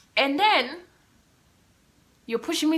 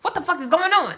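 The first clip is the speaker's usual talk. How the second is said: higher and louder, disbelieving; shocked; 10 semitones higher, 10 dB louder than usual. Retold or imitated. Reading the email, shocked and louder. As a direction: blurt it out in panic.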